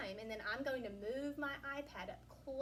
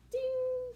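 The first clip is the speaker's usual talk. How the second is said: sound effect; 13 semitones higher, 9 dB louder than usual. Acted.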